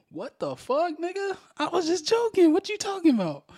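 high, loud retold outburst; shocked disbelief. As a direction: act stunned that she took the joke seriously. His shocked reply retold.

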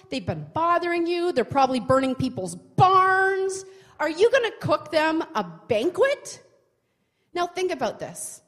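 ordinary talk

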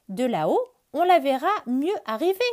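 high, loud quick bird voice; bird speaking. Acted. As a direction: chirp it from the treetop. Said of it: "dit Oiseau".

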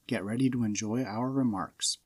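normal talking voice

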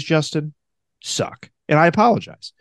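normal talking voice

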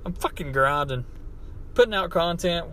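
ordinary talk, nothing marked

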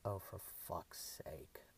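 low, slow, weary mutter. A narrator's reading. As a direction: grumble it under your breath. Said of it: "a male voice muttered".

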